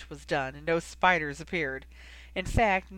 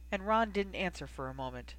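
ordinary delivery, nothing marked

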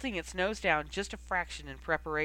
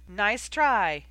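louder smirking call; smirking holler; 2 semitones higher, 7 dB louder than usual. A narrator's reading. Knight mocking Harry's failed feint, smirking holler. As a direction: holler it with a smug grin. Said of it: "Knight hollered, smirking".

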